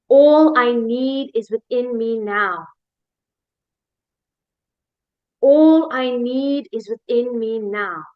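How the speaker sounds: slow, measured affirmation; slow and deliberate, for others to repeat. Shown as a demonstration. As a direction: declare it slowly, word by word. Affirmations spoken to repeat after.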